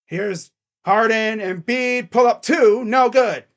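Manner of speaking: lightly voiced announcer call; live play-by-play. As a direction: calmly call the possession. Streamer calls the game live.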